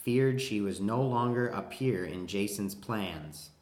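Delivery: deliberate, crisply enunciated read-aloud; careful sentence reading. Acted. Reading sentences about Medea and Jason, careful read-aloud. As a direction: read each sentence out clearly and evenly.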